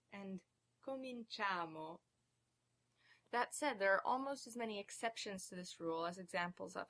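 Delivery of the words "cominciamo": cominciamo with "cia" drawn out; penultimate stress. Shown as a demonstration. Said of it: "stress on the second to last syllable".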